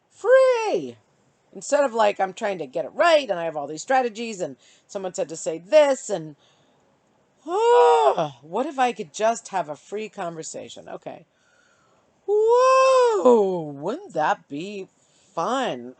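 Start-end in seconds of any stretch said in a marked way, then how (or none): 0.1-1.0 high, loud cry of freedom; elated free; joyful shout. Real, not acted. Imagining freedom, exclaimed. 7.5-8.4 delighted oh. Real, not acted. Imagining free conversation. 12.3-13.7 high, loud thrilled cry; thrilled whoa; excited whoa. Real, not acted. Imagining free conversation.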